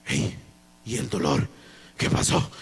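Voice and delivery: low astonished murmur; amazed. Retold or imitated. Retold discovering pain gone. (asombro en voz grave)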